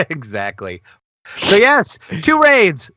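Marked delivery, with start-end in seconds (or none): 1.4-2.9 much higher, louder proclamation; excited announcement. Real, not acted. Host announcing two hinted raids to listeners, excited. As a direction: hail it loudly, pitched way up. Host trumpets the raid news.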